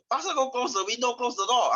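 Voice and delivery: high, urgent mom hurrying. Retold or imitated. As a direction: shoo the kids like a worried mom. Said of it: "your mom will tell you".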